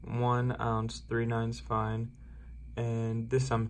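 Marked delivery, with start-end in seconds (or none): none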